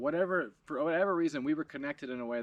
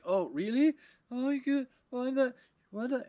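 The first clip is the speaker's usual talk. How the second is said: higher, startled, halting voice of a confused stranger; 11 semitones higher, 5 dB louder than usual. Retold or imitated. Startled Frenchman reacting to the prank, lightly voiced, halting confusion. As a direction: sputter in a lightly voiced foreign man's shock. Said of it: "And he's like".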